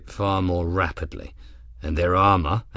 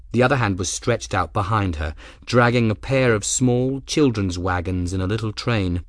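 ordinary delivery

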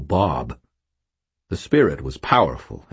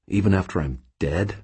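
plain reading voice

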